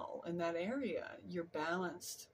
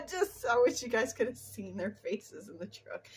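words through real laughter, higher and louder; laughing while talking; 5 semitones higher, 6 dB louder than usual. Real, not acted. Telling viewers about the crew's faces, still laughing.

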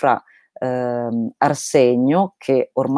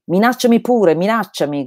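shout without malice; 7 semitones higher than usual. Acted. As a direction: call it across the water, teasing, no anger. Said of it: "gridò al folle in Cristo Karp".

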